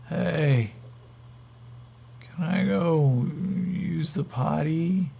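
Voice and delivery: high, slow dazed request; stoned captive asking. Acted. Stoned captive asks the guard.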